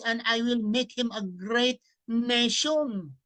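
slow, loud, solemn Bible reading; scripture reading. Acted. Preacher reading the Bible verse aloud to listeners, solemn declaiming. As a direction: proclaim each verse slowly to the audience.

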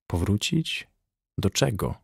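soft, distracted questioning; absent-minded. A narrator's reading. Jim asks while staring at the clock, absent-minded. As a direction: ask it softly, mind elsewhere. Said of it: "zapytał z roztargnieniem".

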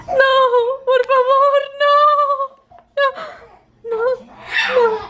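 high loud playful pleading; hammed-up begging; mock pleading.

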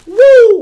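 loud, high-pitched woo; whooping; high celebratory whoop. Real, not acted. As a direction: let out a piercing whoop of triumph. Celebrates empty bubble wrap.

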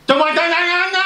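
very high, sustained mock-Cantonese screech; hammed-up high-pitched foreign yelp; mock Cantonese outburst. Absurd loud mock Cantonese, supposed whisper.

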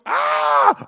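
high loud hammed-up scream; mock scream. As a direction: let out a shrill joking scream. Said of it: "You wouldn't be going".

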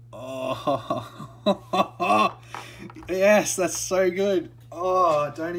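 giddy over the pull; real delighted laughter; laughing joy. Real, not acted. Joy at the card.

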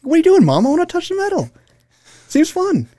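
very high put-on child voice; little kid. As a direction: squeak like a protesting little kid. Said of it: "when you were a kid".

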